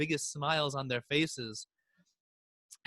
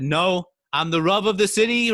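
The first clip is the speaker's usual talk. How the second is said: loud, commanding rabbi; stern rabbi silencing the crowd; firm command; 4 semitones higher, 7 dB louder than usual. A narrator's reading. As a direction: assert authority over the crowd. Rabbi overrules frightened crowd.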